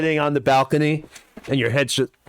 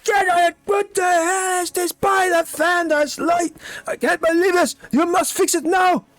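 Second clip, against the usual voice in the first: Turan yelling. Acted. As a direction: rage at the apprentices over the mess. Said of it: "you hear Turan yelling".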